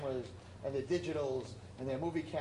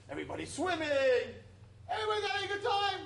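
much higher, louder and fast; gleeful excitement; 5 dB louder, faster than usual. Acted. Describing the happy people at the Catskills, excited. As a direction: gush about the fun everyone is having.

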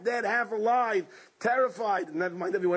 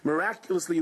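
normal talking voice

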